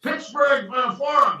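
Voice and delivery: loud, high bark of a place name out the car window; Tony yelling for directions from the car, high and loud; retold yell. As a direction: shout it at a stranger from the car. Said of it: "he would just yell out of the car".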